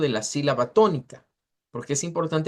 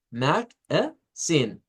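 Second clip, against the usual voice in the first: "Mag-a-zine" very slow, three separate pieces, last one stressed; syllable-by-syllable stress demo; much slower than usual. Shown as a demonstration. Teacher repeats first intonation for students, "Mag-a-zine" split, final stress. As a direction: pull "Mag-a-zine" apart, weight on "zine". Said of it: "la primera entonación sería".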